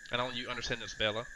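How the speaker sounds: ordinary talk, nothing marked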